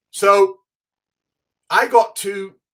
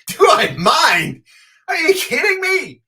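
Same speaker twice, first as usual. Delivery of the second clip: much higher and louder, stretched out slowly; overjoyed disbelief; 12 semitones higher, 9 dB louder, slower than usual. Retold or imitated. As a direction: shout it like the best offer of your life. Asked to take his idol to lunch.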